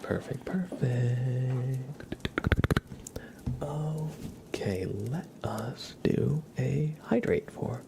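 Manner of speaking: slow breathy whisper; ASMR whisper. Acted. Toasting a drink with chat, slow ASMR whisper. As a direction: whisper slowly, drawing it out.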